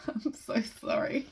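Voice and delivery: apology squeezed out through real laughter; high, breaking up with giggles; giggling. Real, not acted. Reader apologising for botched German, helplessly giggling. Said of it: "I'd be giggling this much".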